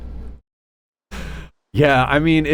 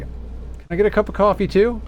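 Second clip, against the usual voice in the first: higher, mock-polite friend asking; mock buddy voice; 9 semitones higher than usual. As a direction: imitate Sean asking sweetly. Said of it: "He's like".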